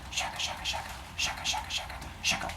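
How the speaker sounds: hushed rubbing-sticks noise; whispered stick-rubbing. Acted. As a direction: whisper the friction sound rhythmically.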